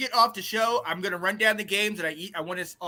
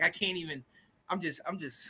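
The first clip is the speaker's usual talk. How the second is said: ordinary delivery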